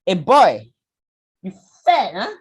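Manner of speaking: loud, high, blunt jeer; bluntly mock someone's weight; loud teasing jab; blunt rude uncle. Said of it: "to your face say".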